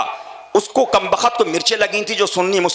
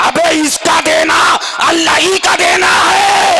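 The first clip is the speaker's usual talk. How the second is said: rhythmic call-and-answer sermon chant; full-force preaching; 6 semitones higher, 4 dB louder than usual. Acted. Preacher answering a critic of the saints, rhythmic full-force preaching. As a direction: drive each refrain, building to a high shout.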